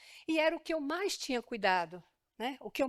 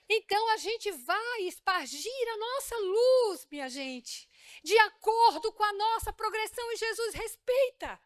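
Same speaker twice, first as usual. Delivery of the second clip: high, loud pulpit proclamation; rising sermon fervor; fervent preaching; 9 semitones higher, 4 dB louder, slower than usual. Real, not acted. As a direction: preach it out to the congregation. Preaching at full force.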